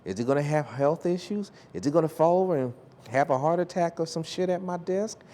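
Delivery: lightly voiced boss sizing him up; imitating a worried employer. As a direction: rattle off a boss's panicked doubts. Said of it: "going like".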